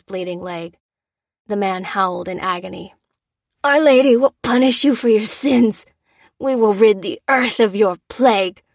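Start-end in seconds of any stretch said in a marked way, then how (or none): 3.6-8.6 loud, higher, strained curse; grunted threat. Acted. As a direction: spit the threat through the pain. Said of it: "grunted the man".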